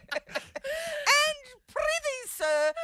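high, slow theatrical declaiming; hammy stage actor. Pretend. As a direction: declaim like an old thespian. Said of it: "You've become a thespian".

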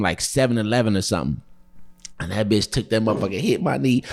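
ordinary delivery

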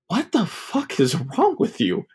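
slow, high-pitched disbelief; exasperated. Retold or imitated. Sick boy confronting laughing uncle, slow and exasperated.

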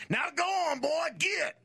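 high, hollered country-guy voice; shouted country drawl demo. Shown as a demonstration. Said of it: "do like a country guy and say".

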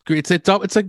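ordinary talk

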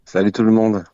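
husky jet-lagged morning voice; vocal fry from jet lag; hoarse morning voice. Real, not acted. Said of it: "quelle voix".

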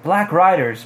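plain reading voice

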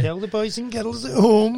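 high, loud, drawn-out host's prompt; mock show host.

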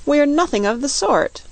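put-on high-pitched, mildly indignant girl. A narrator's reading. Dorothy denying to the Queen they are soup, indignant. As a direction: protest it high, a touch offended. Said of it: "said Dorothy indignantly".

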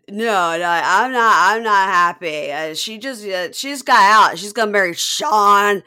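put-on loud, slurred drunk mom voice; slurring drunk. Imitating the drunk mom at the engagement, loud slurring. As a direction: slur it out like a tipsy mother. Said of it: "She's so slurring".

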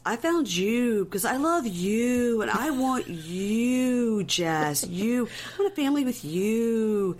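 mock lovelorn declaration, very slow and drawn out; Colt. Suitor declaring his love to Jess, hammed-up drawn-out pleading. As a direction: stretch each 'you' into an exaggerated croon.

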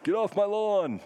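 put-on high, cranky old-timer yell; grumpy old man. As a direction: play the cranky old guy shooing kids. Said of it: "I'm just making myself sound like the old guy".

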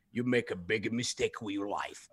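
low, soft put-on voice of a disapproving uncle; Uncle Philippe. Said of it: "random phone calls from uncles".

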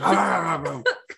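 mock startled cry breaking into a laugh; startled yelp noise. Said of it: "you're like, ... Some kind of noise".

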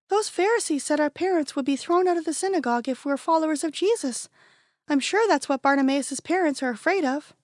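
put-on a young kid asking, puzzled; child's voice. A narrator's reading. A kid among friends asking why, lightly childlike voice.

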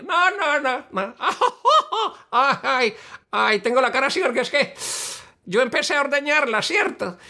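high, simple country fool; high silly Bobo voice. Acted. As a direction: tell it like a proud simpleton. Bobo character voice. (bobo ingenuo con voz aguda)

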